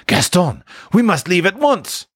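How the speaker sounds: high, urgent summons; deeply shocked. A narrator's reading. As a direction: call your friend away in alarm. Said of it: "Deeply shocked".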